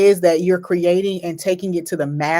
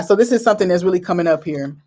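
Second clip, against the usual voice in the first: normal talking voice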